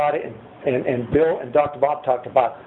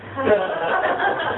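real laugh; 11 semitones higher than usual. Real, not acted. Laughing at own story.

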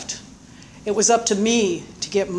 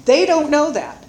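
mock blunt sponsor; 9 semitones higher, 5 dB louder than usual. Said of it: "He goes".

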